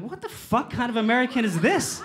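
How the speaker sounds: high-pitched incredulous outburst; mock outrage. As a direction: blurt it in exaggerated disbelief. Stunned reaction to the accent.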